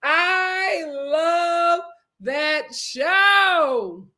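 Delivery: drawn-out, every word stretched; emphatic adoration. Real, not acted. Host gushes over a favorite show, emphatic and stretched. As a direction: savor each word slowly. Fond exclamation after her impression.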